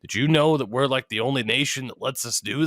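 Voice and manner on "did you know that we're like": usual speaking voice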